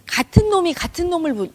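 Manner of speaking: normal talking voice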